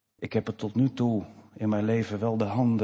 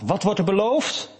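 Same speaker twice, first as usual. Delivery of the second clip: higher, louder grasping ask with stress on beloofd; unbelief's question; 10 semitones higher, 5 dB louder than usual. Shown as a demonstration. Preacher contrasting two questions to the congregation, grasping and raised. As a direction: push beloofd up like someone after the prize. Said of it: "Je vraagt".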